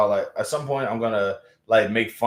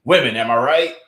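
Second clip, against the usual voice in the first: louder hacky stand-up punchline setup; mock crowd-baiting one-liner; mock comic opener; 3 semitones higher, 7 dB louder than usual. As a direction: toss it out like a hacky comedian. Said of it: "like, going on there and saying".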